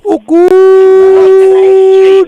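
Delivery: high squeaky oh good; mock falsetto.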